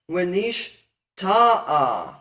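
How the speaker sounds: wenish ta'a, slowly, with TA stressed loud; stress on second-to-last syllable. Shown as a demonstration. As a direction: punch TA in wenish ta'a, drawn out. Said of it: "emphasis moves back to".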